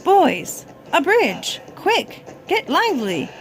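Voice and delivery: high, loud urgent call to the troop; monkey leader crying out. Acted. Monkey leader calls his troop to build a bridge, loud and urgent. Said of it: "cried out to the others".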